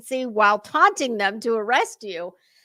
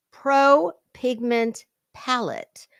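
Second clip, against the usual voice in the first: slow, carefully placing each P; "Pro Pigment Palette" spelled out slowly so each P lands; slower than usual. Real, not acted. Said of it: "I can't say P's very well either".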